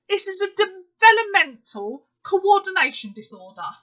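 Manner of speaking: genuinely furious ranting; real anger. Real, not acted. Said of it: "I'm really angry".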